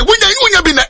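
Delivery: high repeated cry from the pulpit; high preaching cry. Real, not acted. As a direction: lift the repeated phrase high.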